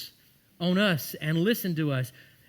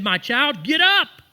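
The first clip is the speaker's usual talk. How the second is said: commanding call; 9 semitones higher, 8 dB louder, slower than usual. Retold or imitated. Jesus raising the girl.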